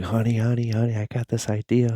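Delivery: bursting out of the shower to tell his wife the idea; excited. Retold or imitated. Husband excitedly calling his wife about a movie idea, pitched high.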